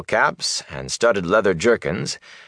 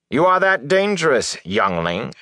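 raised pitch, pressing the question; haughty challenge; 8 semitones higher than usual. A narrator's reading. Narrow-faced man sizing up young Rand, haughty challenge. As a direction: challenge him with a pointed, high-pitched question.

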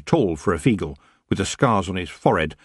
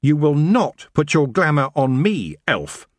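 high, forceful cry of defiance; restrained scream, more forceful than piercing; narrated scream; 6 semitones higher, 4 dB louder, slower than usual. A narrator's reading. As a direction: punch it out, raised and emphatic. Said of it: "She screamed".

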